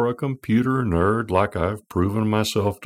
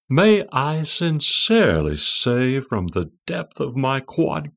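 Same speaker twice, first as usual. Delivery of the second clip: put-on high, slow, sweet English-accented computer voice; a gushing feminine English accent, slow and tender; the newly speaking computer, in a sweet English accent; 4 semitones higher than usual. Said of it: "with a sweet and feminine English accent".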